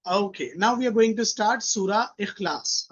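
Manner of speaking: ordinary talk, nothing marked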